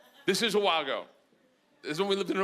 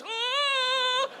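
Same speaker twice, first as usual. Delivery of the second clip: disgusted gasp. Acted. Acting the fart smell.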